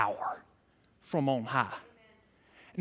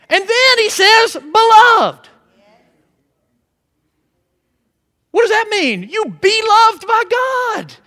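high, loud, fervent preaching; exultant preaching to the congregation; full-force preaching; 8 semitones higher, 6 dB louder than usual. Acted. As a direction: preach it with rising fire.

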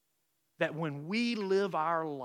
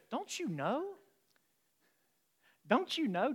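higher, rising questioning; young Jesus questioning; 9 semitones higher than usual. Retold or imitated. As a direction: ask it like a boy surprised at his parents. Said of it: "And he goes".

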